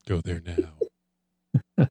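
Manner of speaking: very slow and low, each word stretched; dragged-out slogan. Pretend. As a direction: draw it out like a slow-motion movie trailer. Repeats slogan, slowed way down.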